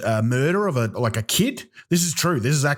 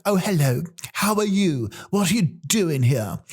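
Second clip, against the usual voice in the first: higher-pitched mock English accent; English regional accent; 4 semitones higher than usual. Comedian mimicking locals in England, mock English accent. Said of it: "people sound totally different".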